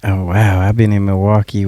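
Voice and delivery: ordinary delivery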